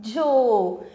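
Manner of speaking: झो slow, with the "oh" held; teacher's model झो with a stretched "oh"; long O sound. Shown as a demonstration. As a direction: draw out झो as "jhohh". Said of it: "तो इसको पढ़ेंगे हम".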